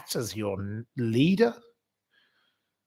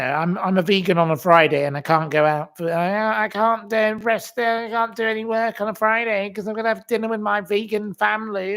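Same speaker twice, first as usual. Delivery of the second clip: mocking vegan voice; 7 semitones higher than usual.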